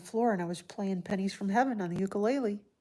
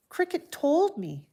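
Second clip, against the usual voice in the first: usual speaking voice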